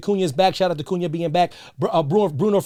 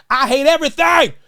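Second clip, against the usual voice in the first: higher and louder burst of frustration; fed-up fan bursting out, louder; outburst; 6 semitones higher, 6 dB louder than usual. Real, not acted. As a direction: explode at the end. Climax of fan's despair rant.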